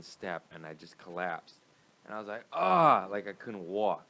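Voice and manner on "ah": mock pain cry. Retold collapse in pain.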